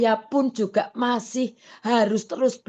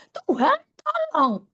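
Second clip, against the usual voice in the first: pleading cry; 13 semitones higher than usual. Retold or imitated. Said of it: "saya juga teriak".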